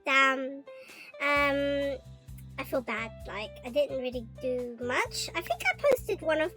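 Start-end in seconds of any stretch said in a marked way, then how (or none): none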